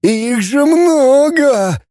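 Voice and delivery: very high, drawn-out howl; slow, high-pitched creature voice; wailing humanoid. A narrator's reading. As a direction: stretch the protest into a high wail. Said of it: "взвыл семигуманоид".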